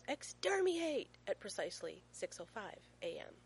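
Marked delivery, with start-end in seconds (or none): 0.0-1.1 high, loud, drawn-out battle cry; piercingly high little-boy shout, stretched out; child's yell. A narrator's reading. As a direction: yell it to wake sleeping parents. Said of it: "and yells".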